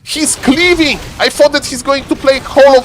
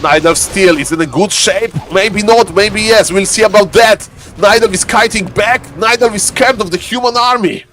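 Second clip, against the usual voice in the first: ordinary talk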